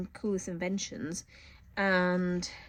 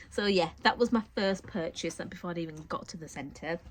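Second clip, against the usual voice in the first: ordinary talk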